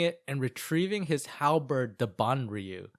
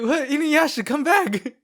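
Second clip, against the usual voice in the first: high, loud cry after someone; mock calling out; 12 semitones higher, 9 dB louder than usual. Calling Inuyasha to come back, hammed-up high shout. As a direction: call them back in pretend dismay.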